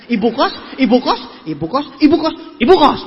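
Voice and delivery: "ibu kos" with a new tune each time, ending loud; vary the stress on each "ibu kos"; change "ibu kos" from rising question to loud exclamation; varied intonation. Shown as a demonstration. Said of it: "beda tekanan intonasinya".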